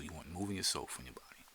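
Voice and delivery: quick whisper of move in your soul from your body; hushed guided-trance whisper. Acted. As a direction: breathe the line out fast and unvoiced. Repeats the instruction in a whisper.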